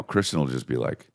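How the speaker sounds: ordinary talk, nothing marked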